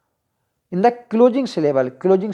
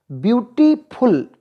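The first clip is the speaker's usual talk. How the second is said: Beautiful broken into drawn-out beats; beautiful sounded out slowly for counting syllables; syllable demonstration; 4 semitones higher, slower than usual. Shown as a demonstration. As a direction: say beautiful in slow, separated chunks, beauty then फुल. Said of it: "टू syllable हो गया".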